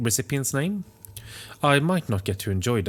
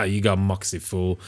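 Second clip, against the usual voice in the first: normal talking voice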